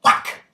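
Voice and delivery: loud imitated whack of a slap; slap sound. Retelling the critic's slap in the movie, loud whack. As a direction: smack the sound out sharply. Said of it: "he goes".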